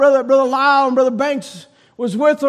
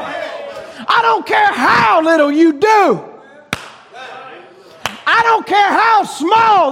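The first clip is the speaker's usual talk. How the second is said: full-force preaching; 7 semitones higher than usual. Acted. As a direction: preach it out to the congregation.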